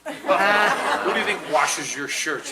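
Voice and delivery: loud, slow, laughing through it; loud laughing payoff; laughing punchline. Retold or imitated. Mom's gotcha line translated, laughing.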